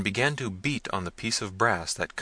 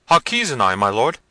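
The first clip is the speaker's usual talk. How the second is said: loud, high-pitched servant; flustered servant; 4 semitones higher, 10 dB louder than usual. A narrator's reading. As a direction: report loudly in a flustered high voice. Frightened servant reports.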